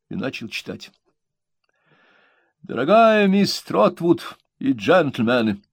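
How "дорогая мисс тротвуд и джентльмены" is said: high, slow pompous letter reading. A narrator's reading. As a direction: read it like a grand proclamation. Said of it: "С хорошо мне знакомым напыщенным видом".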